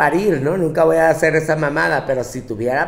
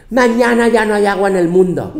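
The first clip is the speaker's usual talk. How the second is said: mock alarm; 5 semitones higher, 6 dB louder than usual. Alarmist Facebook news.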